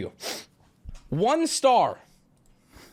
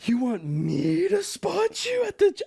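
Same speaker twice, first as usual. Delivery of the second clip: mock shocked disbelief; 10 semitones higher, slower than usual. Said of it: "I didn't say that one".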